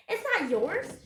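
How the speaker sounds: loud, high-pitched objection; child yelling at a thief; shouted protest. A narrator's reading. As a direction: shout it indignantly. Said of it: "shouted Annie".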